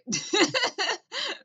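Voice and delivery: high cackle of a laugh. Real, not acted. Comic laughing at his own joke, high cackle.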